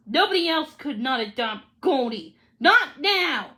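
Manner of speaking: ordinary delivery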